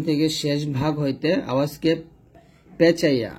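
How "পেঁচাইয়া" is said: ordinary talk, nothing marked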